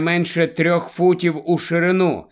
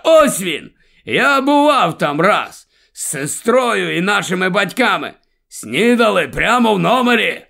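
raised, louder voice of an animated grandfather; excited grandfather; 6 semitones higher, 4 dB louder than usual. A narrator's reading. Grandfather recalling the old inn to his family, excited. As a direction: play a grandfather excitedly pointing out an old haunt.

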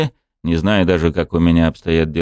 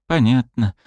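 sad; 7 semitones higher than usual. A narrator's reading. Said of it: "произнесла она печальным голосом".

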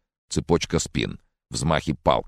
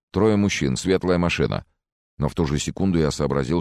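ordinary delivery

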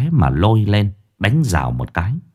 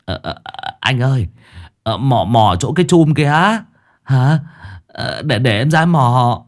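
halting, higher, stammering plea; tearful, reluctant henchman; whimpering, near tears; 4 semitones higher than usual. Acted. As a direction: stall fearfully, whining to the boss. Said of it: "thì mếu máo".

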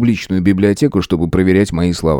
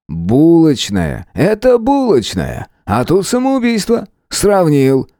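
drawn-out, high-pitched mocking scorn; caustic; 8 semitones higher, slower than usual. A narrator's reading. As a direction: scoff slowly at a silly comparison. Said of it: "язвительно произнёс начальник отделения". (язвительно, нараспев)

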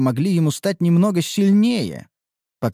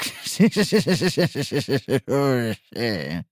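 villainous laugh. Acted. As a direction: cackle like a scheming villain. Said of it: "злодейски рассмеялся Харан".

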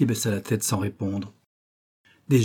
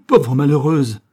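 loud, menacing cry; cried out; 4 semitones higher, 9 dB louder, slower than usual. A narrator's reading. The furious father cries at his daughter, a loud cry. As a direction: hurl it at her, full of dread. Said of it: "s'écria Martin Rigal".

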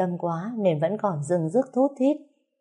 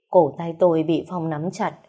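plain reading voice

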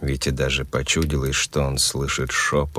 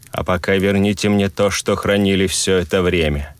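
commanding and cold; 4 semitones higher than usual. Acted. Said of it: "властными и холодными".

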